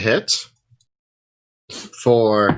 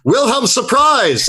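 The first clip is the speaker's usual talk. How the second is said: high-pitched hero's surprise yell; triumphant battle yell; 11 semitones higher than usual. Acted. Swordsman bursting from the water at the creature, yelling. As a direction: yell it high, springing an ambush. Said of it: "bursts from the water and yells".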